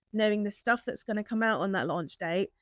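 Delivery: ordinary talk, nothing marked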